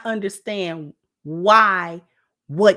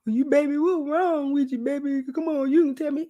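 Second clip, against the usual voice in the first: lightly higher, imitating a hovering husband; a hint of a doting husband nagging baby; mildly mocking her husband's coaxing; mock pestering husband; 5 semitones higher than usual. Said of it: "Ask me that fifty eleven times".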